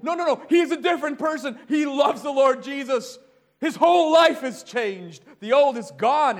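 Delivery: nearly shouting the good news about John; excited neighbor. Retold or imitated. Neighbor insists John has changed.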